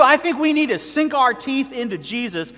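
fervent sermon climax; full-force preaching. Acted. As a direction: drive it home from the pulpit, stretching stay focused. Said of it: "I'm preaching to myself this morning".

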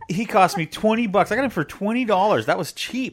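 high-pitched, insistent justification; indignant defence. Real, not acted. Husband defends cardboard Picard's price to wife, indignant. Husband argues cutout was worth keeping.

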